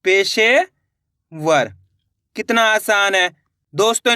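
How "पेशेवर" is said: drawn out very slowly, syllable by syllable; slow teacher blending; slow blended word. Shown as a demonstration. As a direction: stretch पेशेवर out slowly as the letters join. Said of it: "तो इस तरीके से अपने मिलके बन जाएगा ये".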